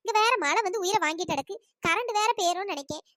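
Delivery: ordinary delivery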